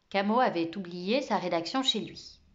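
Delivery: usual speaking voice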